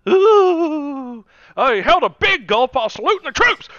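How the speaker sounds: high, loud shrieking "Ooh", then mock outrage; mock scandalized pundit. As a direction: parody a hysterical news anchor. Mocking Obama scandal outrage.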